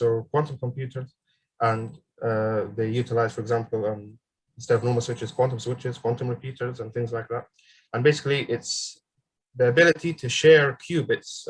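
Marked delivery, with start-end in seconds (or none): none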